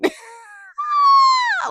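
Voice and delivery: shrieking burst of laughter; helpless shriek of a laugh; high squealing laugh. Real, not acted. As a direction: crack up in a loud high squeal. Bursts out after mock quote.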